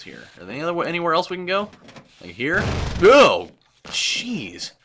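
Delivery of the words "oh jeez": sudden loud startled cry; loud sharp surprise. Real, not acted. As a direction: jump at the scare. Jump scare.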